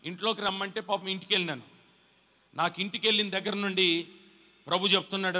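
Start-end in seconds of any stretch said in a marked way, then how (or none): none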